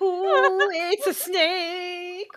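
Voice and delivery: mock exclamation. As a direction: stretch it out in a goofy high voice.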